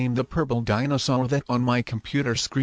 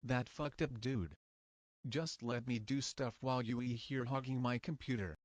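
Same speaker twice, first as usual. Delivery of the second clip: muttering to himself; 16 dB quieter than usual. Acted. Paul grumbling alone at his computer.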